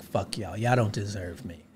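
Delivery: quiet dismissal. Retold or imitated. Comedian retelling how he dismissed the crowd. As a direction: write them off under your breath.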